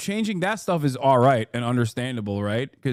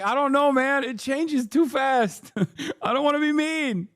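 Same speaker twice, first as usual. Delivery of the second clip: mock whining; 11 semitones higher than usual. Streamer acting out someone overwhelmed by changing terms, high mock whine. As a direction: whine it way up high, half laughing.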